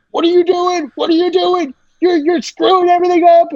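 high, strained frantic voice; panicked director. Retold or imitated. Director grabbing the actor by the throat, panicked. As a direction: play the director losing it.

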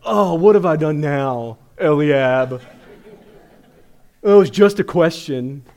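loud, drawn-out indignant whine; whiny younger brother. Retold or imitated. David answers his big brother Eliab in a loud, slow indignant whine. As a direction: protest your innocence like a put-upon kid. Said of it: "classic younger brother".

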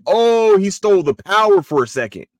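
loud, high "oh" of discovery; high, loud retold revelation; sudden realization. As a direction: act out the penny dropping, loudly.

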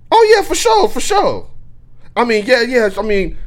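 high, eager for sure; overeager yes. Retold or imitated. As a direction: gush it out too eagerly. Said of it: "volunteering too much information".

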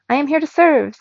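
high, loud eager servant; happy robot. A narrator's reading. Sweeper AI eagerly offering help to boy, happy and loud. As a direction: chirp it out with joy. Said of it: "the sweeper said happily".

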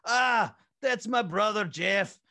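a hammed-up Scottish accent; loud mock Scottish voice; Scottish Stan Van Gundy. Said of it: "he's more Scottish".

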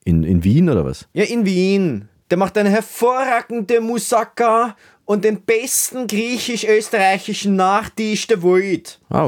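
high, thin Viennese voice greeting; high Viennese sidekick. Acted. Peter greets the listeners in a high Viennese voice. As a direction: play the Wiener sound tech Peter, high.

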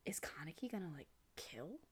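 hushed whisper of suspicion. Retold or imitated. Host whispering a suspicion to co-host about the hero. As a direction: whisper the dark guess. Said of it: "Did you get that feeling?".

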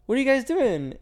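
teacher stopping students in the hallway, high and chirpy; imitating a teacher. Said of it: "the teacher's like".

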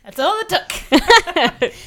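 words squeezed out through laughter; laughing. Real, not acted. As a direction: laugh your way through the line. Laughing at wrong guesses.

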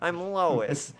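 very high, slow cartoon mom voice; hammed-up falsetto Lois; Lois from Family Guy. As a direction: stretch it out like a bad Lois. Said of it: "Lois from Family Guy".

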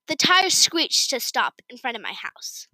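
ordinary delivery

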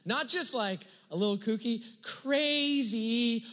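crazy pushed up high and loud; stress on crazy. Real, not acted. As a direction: lean hard on "crazy.".

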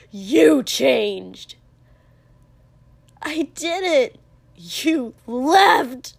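loud, emotional yelling; furious accusation. Acted. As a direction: hurl the blame at them, loudly. Furious accusations during a brawl.

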